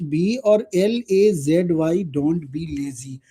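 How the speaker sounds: very slow, word by word for notes; slow dictating teacher; slow dictation. Shown as a demonstration. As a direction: spell it out, then say it slowly. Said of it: "तो कैसे बोलूँगा".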